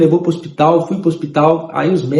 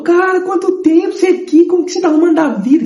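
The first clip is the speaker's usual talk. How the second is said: voz bem mais aguda e animada; reencontro animado; 12 semitones higher than usual. Retold or imitated. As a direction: cumprimentando um velho amigo, empolgado. Amigo animado reencontrando na rua. (amigo animado reencontrando alguém)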